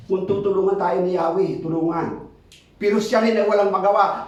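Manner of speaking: ordinary talk, nothing marked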